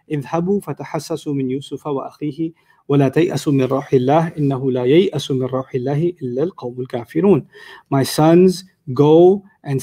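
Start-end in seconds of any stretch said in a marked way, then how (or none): 0.1-7.5 low, measured chant of the verse; Quran recitation. Shown as a demonstration. Teacher recites the ayah to his class, chanting low. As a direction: recite the Arabic like a reciter, lower than speech.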